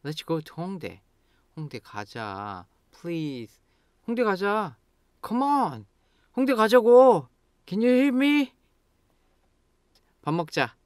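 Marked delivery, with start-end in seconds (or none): 1.6-3.6 quiet, stretched-out begging; pleading. Shown as a demonstration. Begging a friend to go to 홍대, pleading. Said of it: "Now you are pleading". 4.1-5.9 high, insistent coaxing; urging. Shown as a demonstration. Nagging a friend to go out, urging. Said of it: "just changing the pitch". 6.4-8.5 high, loud exasperation; angry. Shown as a demonstration. To a friend ignoring the plan, angry. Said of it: "Then you get angry and say".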